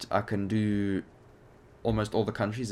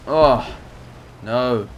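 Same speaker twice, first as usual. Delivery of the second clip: alarmed cry; 3 semitones higher, 9 dB louder than usual. Real, not acted. Enemy Spitfire on his tail.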